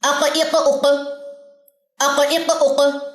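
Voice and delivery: syllables अक, इक, उक said with a bouncing echo on the क; qalqala demo. Shown as a demonstration. Teacher demonstrating qalqala to students, echoing क. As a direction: bounce the क at the end of अक, इक, उक. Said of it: "अब मैं इसको एक साथ पढ़ती हूँ".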